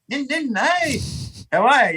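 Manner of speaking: high, chirpy hello, played for laughs; imitating his own overeager greeting from the bike; mock cheery greeting. Riding up to greet her.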